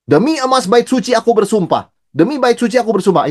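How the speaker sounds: oath-swearing Pharisee. Acted. Quoting people swearing oaths.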